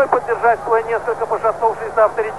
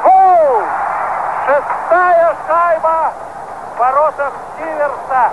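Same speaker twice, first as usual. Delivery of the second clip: excited hockey goal call; 4 semitones higher, slower than usual. Real, not acted. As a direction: scream the goal, then stretch it. Goal scored live.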